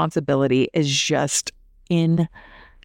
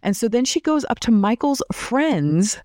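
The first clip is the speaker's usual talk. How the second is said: ordinary talk, nothing marked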